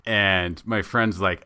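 ordinary talk

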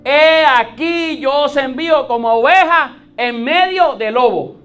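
high, loud scriptural proclamation; full-force preaching. Real, not acted. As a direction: declaim it to the congregation, stretching the quoted words. Preaches, declaiming Jesus's words. (prédica a viva voz, proclamando la Escritura)

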